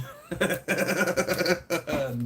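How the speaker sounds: long rippling chuckle; amused laugh. Real, not acted. Streamer tickled by a viewer's comment, amused laugh. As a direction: laugh in a steady run of ha-has.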